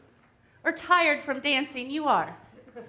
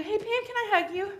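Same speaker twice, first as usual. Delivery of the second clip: grown-up asking; 7 semitones higher than usual. Acted. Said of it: "a grown-up comes up to you and says".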